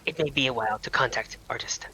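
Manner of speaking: hushed whispered aside; whispering. Real, not acted. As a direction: whisper it under your breath. Quiet aside to the table.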